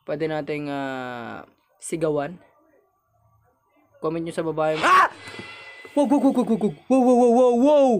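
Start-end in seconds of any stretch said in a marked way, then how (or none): 4.9-5.1 short, loud scream of fright; startled yelp. Real, not acted. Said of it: "Legit natakot ako doon". 5.9-7.9 high, loud panicked stammer of wows; genuinely startled. Real, not acted. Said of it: "Legit natakot ako doon".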